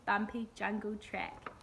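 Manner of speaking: usual speaking voice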